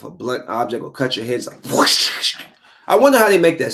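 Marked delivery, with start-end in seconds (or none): 1.7-2.4 breathy imitated whoosh, drawn out slowly; slow voiceless swoosh of a weapon; whoosh sound effect. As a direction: make the swish of a blade cutting air. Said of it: "the sound they make when they cut".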